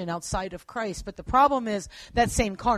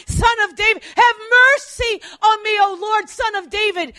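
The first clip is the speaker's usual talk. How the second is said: much higher and louder, pleading cry repeated; desperate shouting; 9 semitones higher, 9 dB louder than usual. Acted. Desperate woman shouting at Jesus, loud pleading cries. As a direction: hammer the plea again and again like a desperate mother. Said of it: "who is shouting it over and over again".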